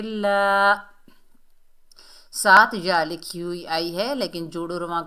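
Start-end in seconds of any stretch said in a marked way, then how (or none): 0.0-0.7 ला held long and loud; stretched long vowel. Shown as a demonstration. Teacher shows one alif's length, stretched ला. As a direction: draw ला out on one pitch. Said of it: "खींच कर पढ़ेंगे".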